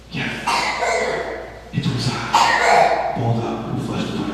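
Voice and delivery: low, hushed rasp; mysterious raspy creature reveal; raspy cat voice. Acted. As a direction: rasp it softly like a talking cat. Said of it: "this raspy voice that says".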